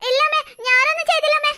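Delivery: put-on high, fast child's denial; pleading denial. Girl denying lipstick to her mother, pleading high.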